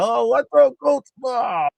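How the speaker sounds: high, loud mock wail; mock cry. Mimicking the player who went flying, high mock cry.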